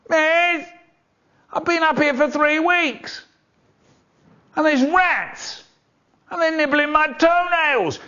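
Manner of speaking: put-on very high child's wail; whining prisoner. Pupil strung up in school prison complaining to teacher, wailing.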